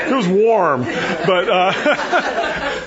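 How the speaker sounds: chuckling through the words; laughing at own joke. Real, not acted. Preacher laughing at his chariot-of-fire joke, chuckling. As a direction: laugh at your own pun. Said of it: "well, it probably wasn't cool".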